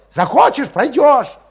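put-on high, loud, snarling young voice; snapping angrily. A narrator's reading. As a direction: bite back at him, high and loud. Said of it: "окрысился вдруг Валет".

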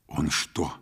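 hushed, cautious question; soft near-whisper. A narrator's reading. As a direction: ask it under your breath. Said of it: "спросила шёпотом".

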